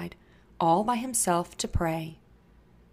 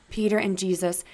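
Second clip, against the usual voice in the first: ordinary delivery, nothing marked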